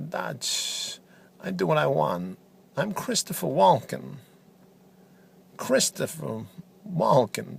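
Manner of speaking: a hint of Christopher Walken, choppy pauses; deadpan Walken-style commentator. Walken impression continued.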